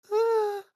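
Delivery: high breathy anime moan. Retold or imitated. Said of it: "it was like".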